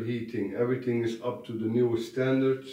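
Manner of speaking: normal talking voice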